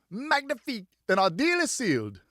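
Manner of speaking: high, bright French cry of triumph; delighted exclamation. Acted. Richard sealing the deal with a tipsy friend, delighted. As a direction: crow it out like a pleased merchant.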